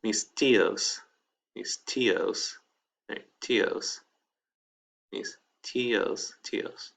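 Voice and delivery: "Mis tíos" said slowly and clearly; slow model. Shown as a demonstration. Teacher modeling vocabulary for students, slow. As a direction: stretch "tíos" with a falling end. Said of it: "teacher models slowly for students".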